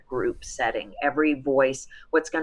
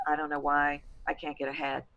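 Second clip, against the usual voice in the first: soft, subdued imitation of a client; quietly voiced, muted complaint; stuck adult voice; 2 semitones lower, 6 dB quieter than usual. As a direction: act out someone whose voice stays held in. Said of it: "you're going".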